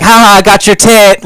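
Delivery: high, loud schoolyard taunt; middle school bully. Acted. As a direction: jeer it like a bratty bully. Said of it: "like a middle school bully".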